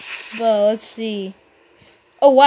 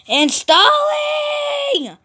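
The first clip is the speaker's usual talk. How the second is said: "installing!" pulled long, much higher; impatient wail; 13 semitones higher, much slower than usual. Real, not acted. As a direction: whine it out in frustration.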